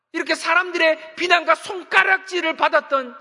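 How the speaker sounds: loud, high-pitched sermon delivery; fervent preaching. Acted. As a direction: preach it with rising fervor. Preacher building to full force.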